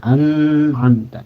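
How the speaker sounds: "An'amta" slow, with the N pressed and held. Shown as a demonstration. Teacher shows the pressed N in "An'amta" as the fault. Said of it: "ditekan bunyi N-nya".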